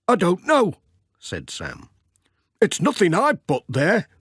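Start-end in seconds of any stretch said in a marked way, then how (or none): 0.1-0.8 higher, puzzled shopkeeper voice; Sam the shopkeeper. Acted. Sam shrugging at the bundle, higher puzzled voice. 2.6-4.1 higher, puzzled shopkeeper voice; Sam the shopkeeper. Acted. Sam shrugging at the bundle, higher puzzled voice.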